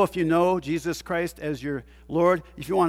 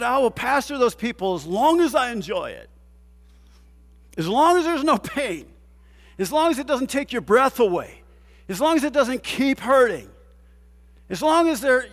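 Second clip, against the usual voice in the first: 1 mock complaining; 5 semitones higher than usual.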